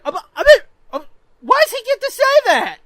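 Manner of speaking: high, loud whiny kid-brother imitation; indignant protest. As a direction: sputter in mock outrage like a wronged child. Brother protests mom's laughing.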